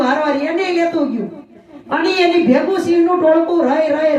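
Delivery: ordinary talk, nothing marked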